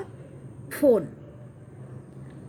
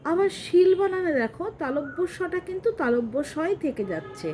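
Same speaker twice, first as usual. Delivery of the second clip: ordinary delivery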